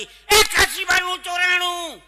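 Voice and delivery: loud, high, drawn-out holler; sudden shout. Retold or imitated. Man suddenly yells a theft alarm on the train, shouting. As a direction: bellow it like a public alarm, stretched out. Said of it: "એક જણે ઓચિતાની બૂમ પાડી".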